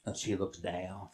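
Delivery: very low, soft voice; low looking down. Acted. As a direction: drop right down with her gaze. Horse looking down, acted by pitch.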